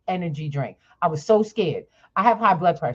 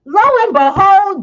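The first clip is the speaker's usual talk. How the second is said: much higher and louder storytelling burst; excited build-up; 13 semitones higher, 11 dB louder than usual. Real, not acted. Telling the host how the vendor appeared, excited and loud. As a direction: throw it out like the big reveal.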